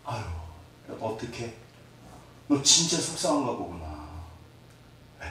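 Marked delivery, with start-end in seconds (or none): 0.1-3.9 put-on softer, tender words to a crying child; a mature parent comforting softly; gentle comfort. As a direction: soothe the child at eye level. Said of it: "눈높이를 맞춰줘".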